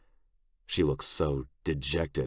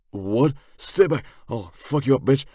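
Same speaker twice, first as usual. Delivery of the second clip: louder, higher startled yelling; startled threat; 9 semitones higher, 7 dB louder than usual. A narrator's reading. Toole elbowed out of a bad dream, startled threat. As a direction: jolt awake yelling threats. Man jolts awake ready to fight.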